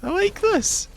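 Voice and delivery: high excited squeal; delighted. Real, not acted. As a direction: squeak with glee. Delighted with the loot.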